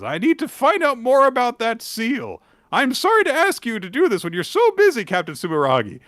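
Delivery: high falsetto, a little cheeky; Gakuro's voice. Acted. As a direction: tease her in the boyish voice. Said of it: "He says".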